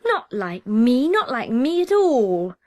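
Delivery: slow, loud protesting cry, leaning on not; a small boy crying out in protest; loud indignant child's cry. Acted. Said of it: "rose in a loud, breathy cry".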